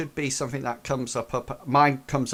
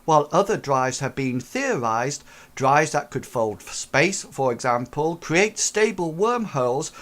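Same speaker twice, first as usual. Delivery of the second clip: louder, higher lecture read as android; formal narration read; 6 semitones higher, 4 dB louder than usual. Acted. Android reads lore.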